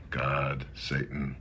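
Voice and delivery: normal talking voice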